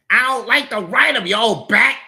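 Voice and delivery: high, loud insult; angry retort. Acted. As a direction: lash out at the old lady. Cousin snaps at Grandma.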